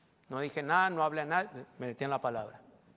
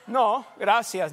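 slow firm refusal; 4 dB louder, much slower than usual. Retold or imitated. Refusing the doctor's exam.

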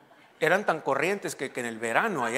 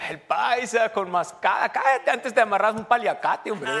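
higher, louder, slower teasing voice; mock heckler; 7 semitones higher, 6 dB louder than usual. Preacher mocks his own scarf in a heckler's voice. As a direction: jeer at yourself like a rowdy friend. Mocking himself in another voice.

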